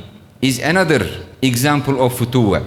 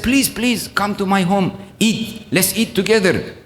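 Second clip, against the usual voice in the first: higher, eager pleading; pleading invitation; 5 semitones higher than usual. Retold or imitated. Ibrahim runs after the guest begging him to return, eager pleading. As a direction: beg him kindly to come back. Ibrahim begs the man back.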